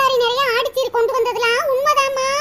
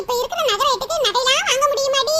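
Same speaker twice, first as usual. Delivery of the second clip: ordinary talk, nothing marked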